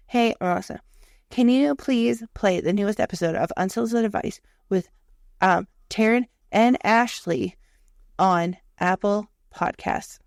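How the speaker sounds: said slowly and carefully for a machine; slow, deliberate command. Shown as a demonstration. Retrying her request to Alexa, slow and deliberate. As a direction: enunciate every word to the speaker. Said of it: "let me just say it really".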